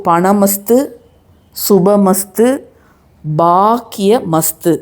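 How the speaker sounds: slow, separated chant words; deliberate repeat for learners to follow; measured recitation. Shown as a demonstration. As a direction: give each word slowly, pausing after it.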